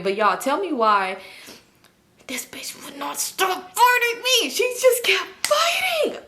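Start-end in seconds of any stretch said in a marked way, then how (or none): 2.3-6.2 slow, strained high squeal; exasperated squeal. Retold or imitated. Telling viewers she would not stop fighting, squealed exasperation. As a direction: squeeze it out high, in mock exasperation.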